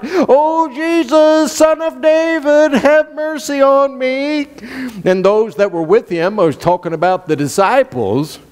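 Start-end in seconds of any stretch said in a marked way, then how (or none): 0.0-4.5 high, pleading cry for mercy; blind beggar crying out. Retold or imitated. Preacher voicing blind Bartimaeus pleading with Jesus, high desperate cry. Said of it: "blind Bartimaeus is crying out".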